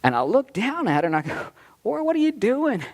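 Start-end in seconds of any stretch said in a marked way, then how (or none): 1.8-2.8 surprised. Retold or imitated. Father surprised when his daughter hugs him, much higher. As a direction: pitch jumps much higher on the question.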